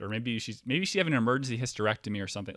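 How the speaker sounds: ordinary delivery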